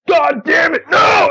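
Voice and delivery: high-pitched frustrated mock yell; exasperated outburst. Comedian botches his drop take, hammed-up high shout. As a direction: blow up at your own flub, shouting high.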